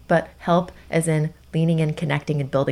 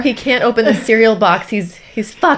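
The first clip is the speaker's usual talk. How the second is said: mock dramatic; 5 semitones higher, 7 dB louder than usual. Mock catastrophizing.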